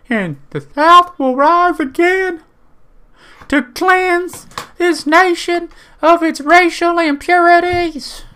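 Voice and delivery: very high, loud, slow hammed-up preacher of hate. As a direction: bellow it like a mock rally speech. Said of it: "the guy I just did an impression of".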